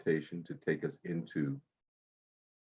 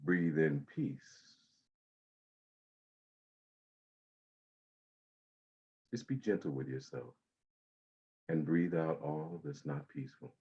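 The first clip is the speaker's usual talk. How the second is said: guided meditation. Acted. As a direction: ease them into calm breathing.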